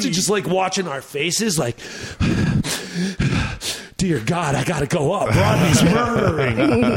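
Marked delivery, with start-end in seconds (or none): 1.8-3.9 long, voiceless breath out; acted nervous exhale; dread-filled breath. Acting out comics' dread watching. 4.0-7.0 mock dread. Acting out dread of following.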